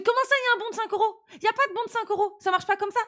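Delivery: high, fast and outraged; indignant disbelief. Retold or imitated. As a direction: fire it off in disbelief. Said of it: "Et je fais".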